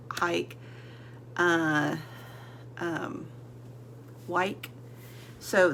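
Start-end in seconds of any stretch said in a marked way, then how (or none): none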